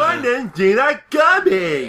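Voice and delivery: loud, high, drawn-out shout; shouted surprise. Acted. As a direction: cry it out high and slow. Shouted reaction over laughter.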